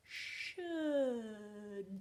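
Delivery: "should" stretched out from sh to a final d; slow blending. Shown as a demonstration. Teacher blends "should" while writing, drawn out slowly. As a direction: glide slowly through "should", landing hard on the d.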